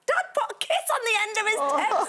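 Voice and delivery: high, laughing news to her sister; excited. Retold or imitated. Telling her sister about dad's text kiss, high and laughing. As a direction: squeal it out, amazed and laughing. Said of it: "I rang my sister and went".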